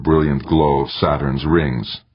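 ordinary delivery

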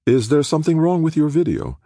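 sweetly pleasant woman, high; mock-pleasant and high; falsely pleasant. A narrator's reading. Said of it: "she said almost pleasantly".

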